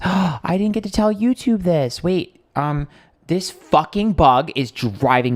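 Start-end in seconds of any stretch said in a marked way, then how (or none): 0.0-2.0 gasping realization. Real, not acted. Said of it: "gasps, remembers".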